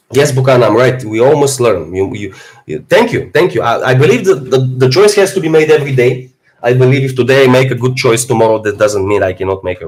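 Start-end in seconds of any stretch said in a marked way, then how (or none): none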